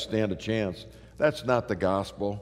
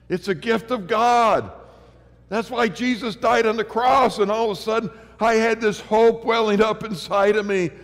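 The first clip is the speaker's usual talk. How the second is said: high, loud sermon climax, slowing on key words; full-force preaching; 9 semitones higher, 6 dB louder than usual. Acted. Preacher telling the crowd of his conversion, rising to full-force preaching. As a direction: build to a ringing pulpit cry.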